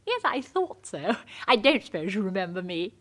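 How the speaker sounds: usual speaking voice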